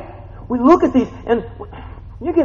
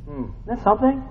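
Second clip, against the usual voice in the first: ordinary talk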